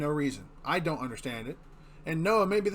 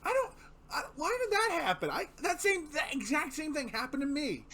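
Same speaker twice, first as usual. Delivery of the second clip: high, astonished; excited disbelief; 9 semitones higher, 3 dB quieter than usual. Real, not acted. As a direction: squeal in disbelief.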